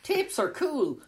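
high, breezy quoted line; Juliet voice. Retold or imitated. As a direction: lightly imitate her airy tone. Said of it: "Juliet saying".

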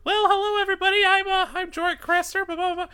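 very high, loud squeaky greeting; high-pitched character voice. Retold or imitated. Imitating the old Jorik voice on the podcast, very high and loud. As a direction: pitch it way up like a goofy fantasy character. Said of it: "he just came out and he goes".

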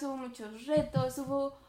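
normal talking voice

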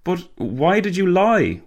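high-pitched, slow dismay; gasping. A narrator's reading. Passenger confronting the pranksters about the lie, gasping. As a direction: gasp it out, aghast. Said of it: "Walter gasped".

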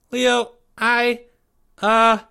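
put-on high, halting child voice; falter, pitched well up; hesitant young boy. A narrator's reading. Timmy unsure how to warn his friend, high and hesitant.

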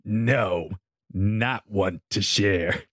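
low, slow growling orc woman. Acted. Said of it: "she growled".

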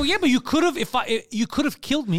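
high, loud retold objection; exasperated comeback in a retelling; heated protest. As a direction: argue back indignantly, reenacting it. Retold argument with driver.

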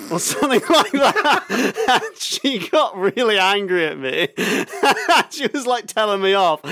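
high, loud laughing speech; laughing hard. Real, not acted. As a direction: laugh through the words. Laughing at own story.

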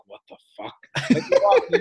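a lightly voiced confused newbie; mock baffled gym-goer. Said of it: "these people are like".

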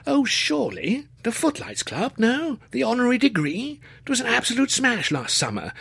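high-pitched posh student, astonished; young Cambridge gentleman. A narrator's reading. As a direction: play the excitable undergraduate, incredulous. Narrator voices Luscombe.

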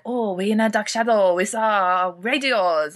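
mock French accent. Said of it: "the French people ... they're like".

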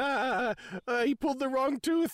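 high, wheezy mock laughter into words; acting hysterical over a blunder; imitated laughing. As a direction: squeal it out between put-on laughs. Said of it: "He's laughing".

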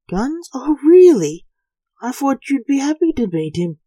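mock their guns, loud and high; sarcastic. A narrator's reading. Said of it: "said Lance sarcastically".

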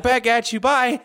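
high-pitched, hurried farewell in character; departing employee. Acted. Employee answering finger guns as he leaves, high character voice. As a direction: toss out a high parting line. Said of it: "and he's like".

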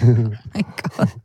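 a low, put-on "oh my God" in fake shock; mock horror. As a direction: drop the voice low in disbelief. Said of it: "I was like".

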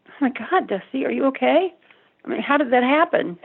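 a mother suddenly frightened for her son; alarmed mother. Retold or imitated. Mother hears son witnessed murder.

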